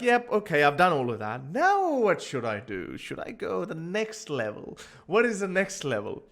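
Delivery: loud, restless, musing about what comes next; bored husband. Retold or imitated. Bored husband planning the next thing, restless and loud. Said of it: "Now they're like".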